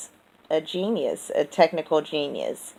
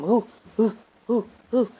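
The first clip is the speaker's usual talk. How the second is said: high ape-like hooting; gorilla hoots; 6 semitones higher than usual. Retold or imitated. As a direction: hoot at him like a gorilla. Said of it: "making a gorilla noise at him".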